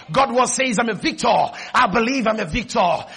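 usual speaking voice

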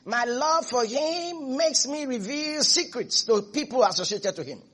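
soft, measured voice of God; softened, deliberate proclamation of love; loving divine declaration. Acted. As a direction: declare devotion quietly, with long pauses.

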